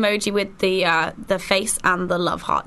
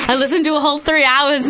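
laughing, louder and higher; 6 semitones higher, 5 dB louder than usual. Real, not acted. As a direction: laugh through the words. Laughing while telling.

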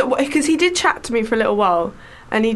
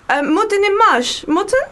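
slow, higher, puzzled French tourist voice; drawn-out confused French asking the station; mock French accent; 7 semitones higher, slower than usual. Lost passenger checking the station, in a slow French accent. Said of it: "doing the French".

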